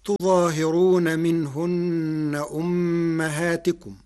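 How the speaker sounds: تظاهرون with light ẓa, long alif and kasra on ha: tuẓāhirūna; slow recitation, تظاهرون as tu-ẓaa-hi-roon, nasal consonants held; recited verse. Shown as a demonstration. Lecturer reciting the verse before explaining it, tuẓāhirūna. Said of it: "قرأ ورش كلمة تظاهرون".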